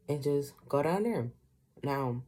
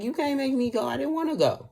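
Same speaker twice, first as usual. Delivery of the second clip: retold temper tantrum; 12 semitones higher than usual. Boy retelling refusing to go with his dad, childish tantrum. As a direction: replay the kid refusing to leave church. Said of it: "I threw a big ass temper tantrum".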